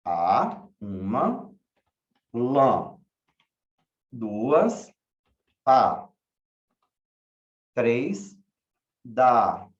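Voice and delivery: "A, lã, pa, da" chopped into isolated syllables, each followed by its number; syllable by syllable count. Shown as a demonstration. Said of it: "Quantas sílabas?". (contando sílaba por sílaba, pausadamente)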